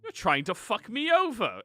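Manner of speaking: loud, high shouted accusation; furious accusation. Acted. Paranoid fat man yelling at the woman in the line, furious. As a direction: hurl it at her, full volume.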